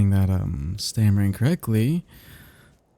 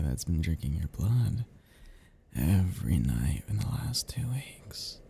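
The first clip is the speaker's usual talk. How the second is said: whispering; 3 semitones lower, 7 dB quieter than usual. Acted. Vampire whispering menacingly.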